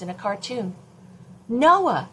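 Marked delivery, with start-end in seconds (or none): 1.5-2.1 higher, louder urgent call; blurted out. Acted. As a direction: burst in and shout the news. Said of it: "she blurted out".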